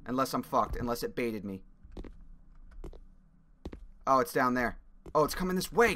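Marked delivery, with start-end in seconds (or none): none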